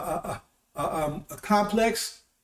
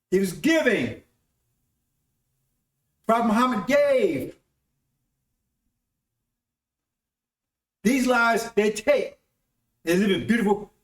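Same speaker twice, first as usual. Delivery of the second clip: raised, fervent preaching voice, higher throughout; 3 semitones higher, 3 dB louder than usual. Acted. Preacher rousing listeners about tithes, fervent preaching. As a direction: preach it out to the congregation with conviction.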